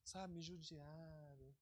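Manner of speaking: slow, soft whimpering complaint; whiny victim. Retold or imitated. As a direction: drag it out like a hurt victim. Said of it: "A pessoa começa a falar".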